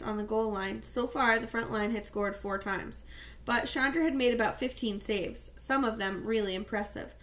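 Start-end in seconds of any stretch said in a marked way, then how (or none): none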